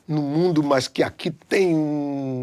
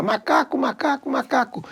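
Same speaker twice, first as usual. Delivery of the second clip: mock yell; 9 semitones higher than usual. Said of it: "começou a gritar".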